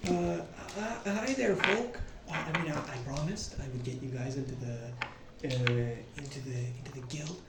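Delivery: hesitant, softly trailing away; weirdly quiet. Acted. Concealing it from the innkeeper lady, weirdly quiet. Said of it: "he says this, like, weirdly quietly".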